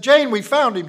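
loud call across the street. Retold or imitated. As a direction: shout the good news to the mother. Neighbor calls out to mother.

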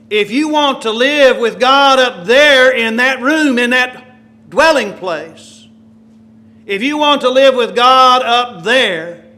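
loud, high, slow preaching; passionate closing appeal. Acted. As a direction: drive the final point home. Closing appeal at full force.

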